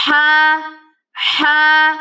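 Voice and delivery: ہا breathed from the middle of the throat, mouth open; throaty ہا. Shown as a demonstration. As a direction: push ہا out from mid-throat. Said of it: "گلے کے وسط یعنی کہ گلے کے درمیان سے نکالیں گے".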